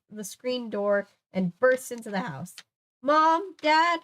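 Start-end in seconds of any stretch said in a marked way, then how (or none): 3.0-4.0 higher, louder call for parents. Acted. Lucy bursts in calling her parents loudly. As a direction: shout for Mom and Dad. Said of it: "My cry was a hoarse".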